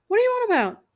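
a startled, incredulous question; surprised. A narrator's reading. Husband surprised by wife's question, incredulous. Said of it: "He looked surprised".

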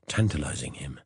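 ordinary delivery, nothing marked

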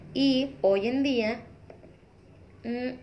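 talking between sips of coffee; sipping coffee. Real, not acted. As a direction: pause mid-sentence for a sip of coffee. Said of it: "perdón, me estoy tomando un café".

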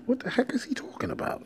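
hushed, baffled whisper to himself; whispered inner thought. Retold or imitated. Boy whispering to himself about his dad's words, hushed disbelief. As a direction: whisper it like a confused kid's private thought.

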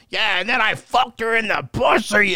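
loud, high mock radio-host bellow; hamming up a shock jock on air; Howard Stern impression. Radio host blaring through empty house, mock shout. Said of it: "you just hear Howard like".

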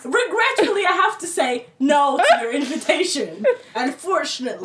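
high, loud, theatrical regret; mock-formal refusal. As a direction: decline grandly, with hammed-up politeness. Performing a mock-formal email refusal.

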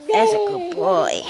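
high slow coo; praise. Real, not acted. Praising baby.

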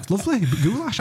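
high put-on voice, mocking a mate; mocking impression. Mimicking Brian praising the goulash, high mocking voice.

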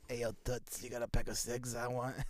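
low, muted smoker caricature; mock smoker bumming a cig; cigarette lady. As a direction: put on a chain-smoker's voice, softly. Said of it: "no cigarette ladies".

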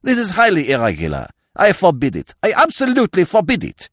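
loud, indignant, rising in pitch; incensed magistrate. A narrator's reading. Magistrate forbidding the interruption, incensed. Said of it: "cried the justly incensed magistrate".

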